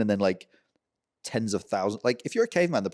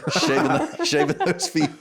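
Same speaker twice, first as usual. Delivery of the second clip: laughing; 6 semitones higher, 4 dB louder, slower than usual. Real, not acted. Laughing at disgusting feet image.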